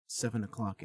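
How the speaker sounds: ordinary talk, nothing marked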